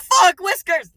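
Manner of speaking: sudden high loud curse; startled yell. Retold or imitated. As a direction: blurt it out in shock. Retelling a startled outburst.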